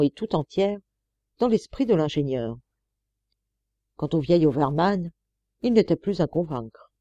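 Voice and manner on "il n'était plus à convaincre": ordinary delivery, nothing marked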